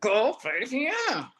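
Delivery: slow, lightly voiced command; the voice gently insisting; a hint of a calm inner voice.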